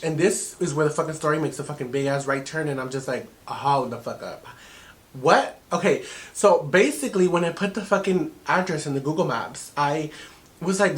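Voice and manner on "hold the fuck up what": low, slow, drawn-out disbelief; stunned disbelief. Retold or imitated. Woman at the big turn of her story, stunned.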